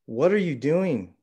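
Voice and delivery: yelling. Acted. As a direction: yell it out in a sudden panic. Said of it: "I yell at him".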